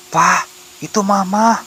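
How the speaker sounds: put-on high, drawn-out little boy voice; high-voiced child alerting his father; child calling out. A narrator's reading. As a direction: call to dad, pitched high and slow. Child points out his mother.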